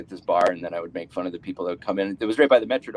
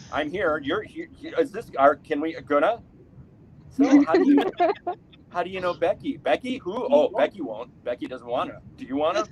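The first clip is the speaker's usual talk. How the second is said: higher, fumbling, unsure questions; awkward hopeful questions; 6 semitones higher than usual. Retold or imitated. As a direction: stammer through nervous awkward questions. Awkward hookup small talk acted.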